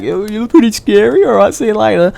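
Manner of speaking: imitating a householder. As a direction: sound politely spooked, pitched right up.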